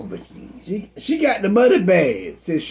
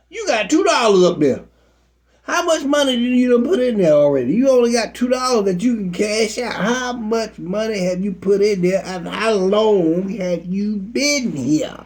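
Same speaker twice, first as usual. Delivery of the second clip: raised, high-pitched indignant questioning; exasperated interrogation; 4 semitones higher than usual. Acted. Pastor confronting a member over church money, exasperated. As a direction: push the pitch up and demand answers.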